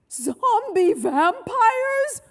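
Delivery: high, loud, drawn-out stage horror; horrified dread. A narrator's reading. Ted guessing what is screaming, drawn-out horror. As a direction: stretch each word in mounting terror. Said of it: "whispered Ted".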